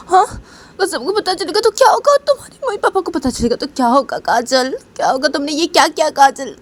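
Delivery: put-on high, loud panicked girl's voice; frightened scolding. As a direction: cry out in alarm at what she did. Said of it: "बोलती कि".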